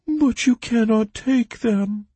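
very high, slow, plaintive squeak; sad creature. A narrator's reading. Bog creature denied the fish, sad. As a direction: whine it sadly in a tiny voice. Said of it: "his ears drooped sadly".

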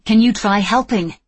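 sharp, loud retort; snapping back. Acted. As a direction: fire it back at him. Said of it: "she fired back".

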